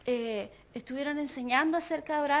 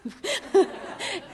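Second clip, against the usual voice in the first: short burst of higher laughter; real laughter; 5 semitones higher than usual. Real, not acted. Preacher recalling her near miss, bursting into laughter. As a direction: break up laughing mid-story.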